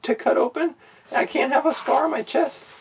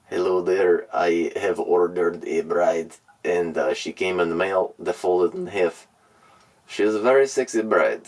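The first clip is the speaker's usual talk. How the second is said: deep creepy impression. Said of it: "listen to this one".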